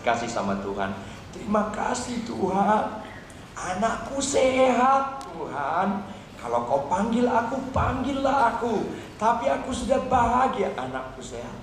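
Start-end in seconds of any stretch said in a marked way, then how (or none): 1.3-10.7 higher, heartfelt cry of thanks; thankful prayer. Acted. Injured mother thanks God that her child is healthy, grateful prayer. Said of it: "senyum dan berterima kasih". 10.8-11.6 much softer and lower; quiet thankfulness. Acted. Injured mother ends her prayer for her child, hushed and grateful.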